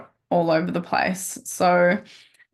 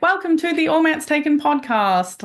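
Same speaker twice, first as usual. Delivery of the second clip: bright smiling podcast intro, higher; 8 semitones higher than usual. Shown as a demonstration. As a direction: say it with a smile. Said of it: "try to smile when you speak".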